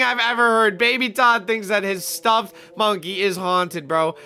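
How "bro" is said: ordinary talk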